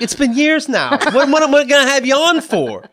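high, laughing mock disbelief; comic teases his doctor, laughing; teasing. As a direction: needle him playfully. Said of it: "I'm like".

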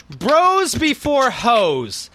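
very slow announcer read; slow dramatic read. Shown as a demonstration. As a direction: drag out each word. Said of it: "reading magazine headline".

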